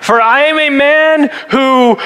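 high, slow, drawn-out proclamation; hammed-up scripture reading; mock-dramatic declaiming. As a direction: stretch it out grandly, then stall. Jokingly restarts the dramatic verse reading.

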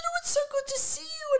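very high, gushing squeal; shrieky mock delight; excited reunion. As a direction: imitate a friend thrilled to bump into you.

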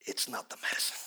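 hushed aside. Retold or imitated. Quiet aside to doctor.

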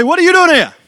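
loud, high surprised call across a street; calling out across the road. Retold or imitated. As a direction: shout it over the traffic to a friend you just spotted. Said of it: "hear this voice calling out to me".

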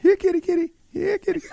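high sing-song call to a cat. Pretend. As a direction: coax the kitty over, high. Calling cats outside.

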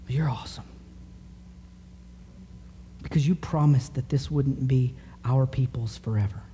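soft hushed devotion; Daniel closing his prayer in a whisper. Acted. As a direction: breathe it out to God. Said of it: "Daniel is praying".

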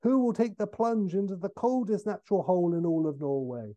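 lightly voiced fisherman making a proclamation; declaiming fisherman. Fisherman over a hole in frozen lake, declaiming. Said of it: "A fisherman".

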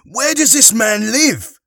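high, loud, drawn-out shout; urgent cry. Acted. Harry to the paramedics about the man, crying out. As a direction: shout the question after them desperately. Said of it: "cried Harry".

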